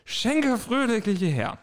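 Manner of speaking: very slow, high slurred toast; drunken slurring. Shown as a demonstration. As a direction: slur a merry drinking call. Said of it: "Mal gucken, wie gut ich lallen kann".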